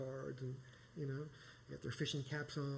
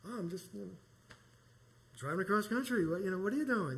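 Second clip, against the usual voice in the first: higher reply; 6 semitones higher, 5 dB louder than usual. Retold or imitated. Replying to stranger.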